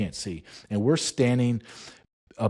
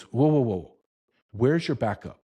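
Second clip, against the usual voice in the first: getting louder; 2 semitones higher than usual. Retold or imitated. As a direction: imitate a father growing mildly louder. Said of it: "And he's getting louder".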